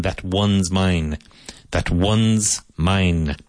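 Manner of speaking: slow, "one's" stretched out clearly; slow contraction. Shown as a demonstration. Teacher slowing down to show the contraction. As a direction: say "That one's mine" very slowly. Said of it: "So here you have a contraction".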